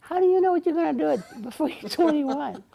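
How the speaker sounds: mock-sceptical retort; incredulous challenge. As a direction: quiz him like a sassy ten-year-old. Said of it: "And I says".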